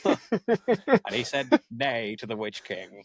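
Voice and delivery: very slow, grand vintage-narrator read; old-fashioned narrator. Retold or imitated. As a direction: drag it out like a dusty narrator. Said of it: "recorded in, like, the late fifties".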